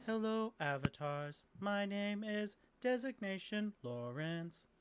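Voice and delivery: slow, monotone robot greeting; courteous automaton, word by word; polite robot quest-giver. Acted. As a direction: speak in held, stepped machine tones. Plays robot quest-giver Lawrence.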